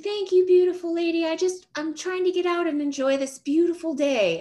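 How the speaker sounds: put-on sweet old man. Imitating the old neighbour's high sweet thanks as she holds the door.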